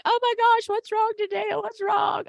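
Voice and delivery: mock fussing grandmother; doting grandma fuss. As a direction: fuss over the baby like a grandma. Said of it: "my mom's like".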